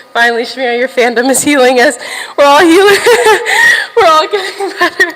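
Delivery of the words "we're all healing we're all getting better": laughing. Real, not acted. Joyful laughing about fandom healing.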